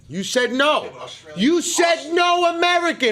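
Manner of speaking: high-pitched shouted objection; heated protest. Real, not acted. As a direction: argue it hotly, voice jumping high. Heated argument over the question's rules.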